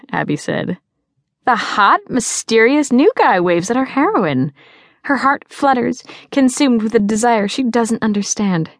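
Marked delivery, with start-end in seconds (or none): 1.5-8.7 swooning, high melodramatic narration; mock-romantic teasing. A narrator's reading. Girl teasing her friend about the new guy, mock-romantic.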